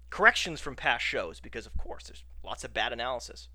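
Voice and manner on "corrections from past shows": normal talking voice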